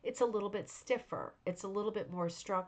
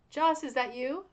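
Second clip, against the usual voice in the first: calling out; 6 semitones higher, 7 dB louder than usual. Real, not acted. Calling to someone in another room.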